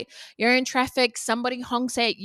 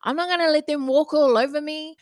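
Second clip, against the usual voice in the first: defiant listener; 5 semitones higher, 5 dB louder than usual. Retold or imitated. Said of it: "they're like".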